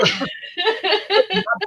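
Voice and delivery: high-pitched, loud giggling burst; bursting laugh. Real, not acted. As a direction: laugh out high at your own joke. Laughs at own mock outburst.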